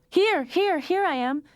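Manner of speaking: very high, loud hurried reply; calling back. A narrator's reading. Lionel answering his mother's call, loud. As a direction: answer fast to cover up.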